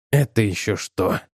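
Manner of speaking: low, displeased grumble; irritated and low-pitched. A narrator's reading. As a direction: grumble it under your breath, low in pitch. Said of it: "недовольно буркнул Брин".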